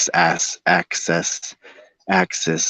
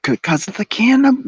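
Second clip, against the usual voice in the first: much higher, louder on cannabis; squeaky high emphasis; 3 dB louder than usual. Real, not acted. Jokingly blaming the cannabis, squeaky high emphasis. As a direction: squeak out the word in mock blame.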